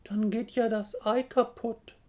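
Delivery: high, loud, tearful protest; sobbing chick. A narrator's reading. Chick fears for its egg, sobbing. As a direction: whine it like an upset chick. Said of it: "schluchzt das Küken".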